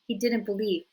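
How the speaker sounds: ordinary delivery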